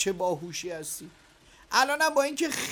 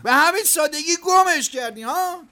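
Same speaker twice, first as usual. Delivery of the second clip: louder, higher reproach; angry scolding; 5 semitones higher, 8 dB louder than usual. Acted. Boss scolding the worker who lost the boy, angry. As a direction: scold him for giving up so easily.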